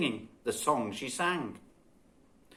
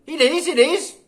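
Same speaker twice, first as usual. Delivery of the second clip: high, loud insisting; a sibling nagging back; insistent child; 13 semitones higher, 10 dB louder than usual. A narrator's reading. Children squabble.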